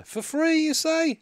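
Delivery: high-pitched feigned astonishment; put-on high voice of polite surprise; mock surprise. Acting out the free-drinks scene.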